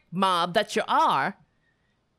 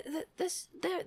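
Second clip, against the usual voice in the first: ordinary talk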